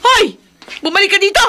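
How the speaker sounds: loud, high angry call; shouting after him. Acted. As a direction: yell for him to come back. Calling after Samuel who walks away. (malakas na sigaw sa papalayong asawa)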